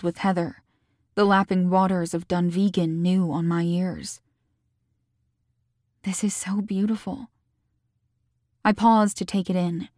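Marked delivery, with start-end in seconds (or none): 6.0-7.3 softer, hushed admiration; quiet awe. A narrator's reading. Heroine alone taking in the loch view, quietly awed. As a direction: marvel softly to yourself.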